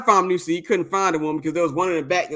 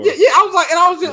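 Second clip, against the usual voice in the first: laughing; 10 semitones higher, 8 dB louder, much faster than usual. Real, not acted. Laughing at his own story.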